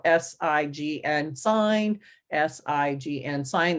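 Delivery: slow, evenly spaced letters, then the word; letter-by-letter spelling. Shown as a demonstration. Teacher showing the tapping routine, slow letter-by-letter spelling. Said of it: "say each letter".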